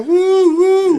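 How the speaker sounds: high, loud party whoops; cheering crowd. Retold or imitated. Club crowd waving at the stuck car, cheering. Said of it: "everybody's out waving, go".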